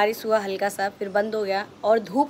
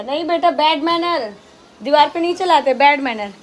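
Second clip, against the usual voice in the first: high, loud firm telling-off; 5 semitones higher, 6 dB louder than usual. Real, not acted. Mother scolds her toddler for a bad habit, high and firm.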